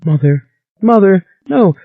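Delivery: a high wail through tears; sobbing. A narrator's reading. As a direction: cry out between sobs.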